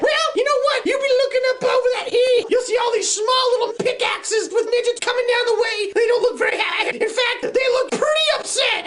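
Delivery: high-pitched cartoon bear voice, well above normal; squeaky story bear. One bear warning another about what's coming over the hill, high-pitched. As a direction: pipe it up high, keeping the volume normal.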